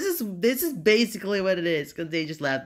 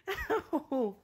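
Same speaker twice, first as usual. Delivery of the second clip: helpless laugh; 11 semitones higher, 7 dB quieter than usual. Real, not acted. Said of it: "I can't control myself laughing".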